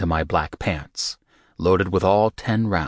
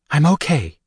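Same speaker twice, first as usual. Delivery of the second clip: higher, louder insistent female voice; Rika insisting; 9 semitones higher, 5 dB louder than usual. A narrator's reading. Rika insists to Michael she is fine. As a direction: Rika pushing back, insisting. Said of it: "she insisted".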